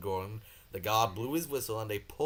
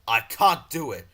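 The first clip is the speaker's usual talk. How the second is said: sulky protest; 9 dB louder than usual. Acted. Gordon sulking.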